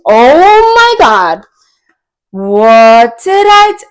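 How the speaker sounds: loud, high, drawn-out laughing exclamation; laughing outburst. Real, not acted. Reader reacts to the card that always stalks her, laughing outburst. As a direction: crack up in loud disbelief, stretching each word. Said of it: "you know why I'm laughing".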